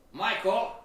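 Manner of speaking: acting a boss shouting a name; boss calling out. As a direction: call the employee over sharply, loud and high. Boss calls him to the office.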